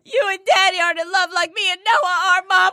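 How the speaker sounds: fast, tearful Southern belle wail; Southern crying accent. Pretend. Hamming a weepy Southern movie line to her friend. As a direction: sob it out like a melodramatic Southern daughter. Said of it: "that much of an accent".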